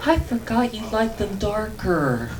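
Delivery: a hint of mom's sweet teasing voice; lightly voiced mom. Son voicing mom's sly apology, lightly. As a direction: deliver the punchline as innocent mom. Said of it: "She goes".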